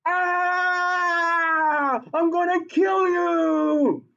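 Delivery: high, slow hammed-up rage; mock furious threat. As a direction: play the iron losing its temper, drawn out and high. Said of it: "Iron is going".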